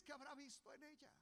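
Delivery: high, loud, driving preacher's cry; fiery sermon climax; full-force preaching. Acted. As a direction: preach it out at the top of the voice.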